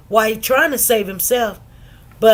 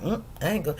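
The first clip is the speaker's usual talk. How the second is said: a mumbled, much softer refusal, lazy dog; mumbled stubborn dog; 4 semitones lower, 11 dB quieter, much faster than usual. Retold or imitated. Owner asks the dog to speak, dog mumbles a lazy refusal. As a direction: play the dog that won't bother.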